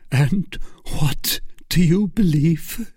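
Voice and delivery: halting, high, anguished question; a touch of choked grief; stifled by sobs. A narrator's reading. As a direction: ask brokenly, holding back tears. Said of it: "His voice was stifled by sobs".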